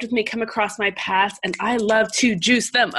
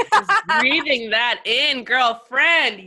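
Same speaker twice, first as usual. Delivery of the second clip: higher, rising into a joyful shout; excited gushing; 5 semitones higher than usual. Real, not acted. Guest answering the host's praise with an excited shout. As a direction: cheer her on with full enthusiasm.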